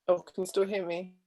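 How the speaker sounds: ordinary delivery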